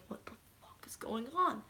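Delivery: whispered, stunned; shocked whisper. Retold or imitated. Viewer whispering in the dark theater, stunned. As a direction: breathe it out in disbelief. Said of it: "I was like".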